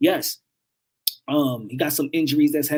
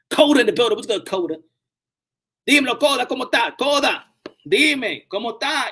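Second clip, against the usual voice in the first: excited greeting; 7 semitones higher, 5 dB louder than usual. Real, not acted. Greeting a viewer in chat.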